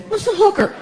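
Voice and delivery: put-on high-pitched kid voice; innocent little boy. Son asks stepdad in kitchen, high innocent voice. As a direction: ask it like a curious small child.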